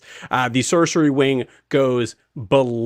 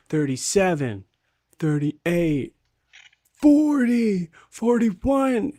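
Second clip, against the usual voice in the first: mock counting; 7 semitones higher, slower than usual. Kids counting mockingly in back.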